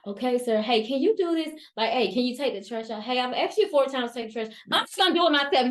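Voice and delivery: building from firm to loud exasperation; escalating. Acted. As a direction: ramp up with each repeated request. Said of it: "My voice is getting more elevated".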